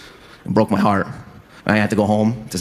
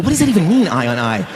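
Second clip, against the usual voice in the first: baffled question; 8 semitones higher, 4 dB louder than usual. Retold or imitated. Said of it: "I go".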